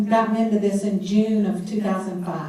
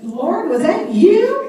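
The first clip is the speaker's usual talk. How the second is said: slow, higher, louder question through laughter; laughing disbelief; 9 semitones higher, 6 dB louder, slower than usual. Retold or imitated. Woman asking God in bed, slow amused disbelief. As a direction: ask God half laughing, half amazed. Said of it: "and I was like".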